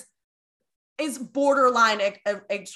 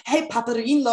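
put-on Southern accent. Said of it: "with my redneck Southern accent trying to speak Italian".

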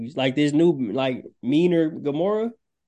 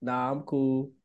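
put-on a light, laid-back New York accent, softer; an understated New York accent, brushing it off; mock New York accent; 3 semitones lower, 5 dB quieter, slower than usual. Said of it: "With the New York accent and all".

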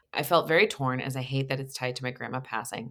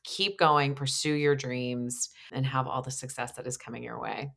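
ordinary talk, nothing marked

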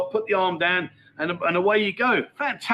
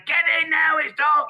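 mum calling in; 9 semitones higher than usual. Retold or imitated. Mum calling kid in from park.

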